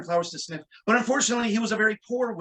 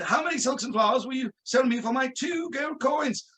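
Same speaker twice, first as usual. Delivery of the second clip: higher-pitched mock British wizard; quasi-British dialect; 4 semitones higher than usual. Wizard haggling for his wife's gift, quasi-British dialect. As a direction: play it in hammed-up British. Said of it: "he had a quasi-British dialect".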